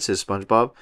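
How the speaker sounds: usual speaking voice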